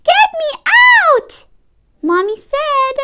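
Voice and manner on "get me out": put-on high, loud child's demand; shouted. A narrator's reading. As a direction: yell it high to be let out. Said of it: "in the end I shouted".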